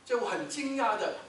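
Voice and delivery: ordinary talk, nothing marked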